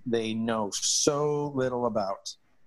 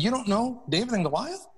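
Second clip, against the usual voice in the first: incredulous question; 9 semitones higher, faster than usual. Retold or imitated. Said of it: "I said".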